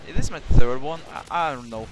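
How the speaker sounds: usual speaking voice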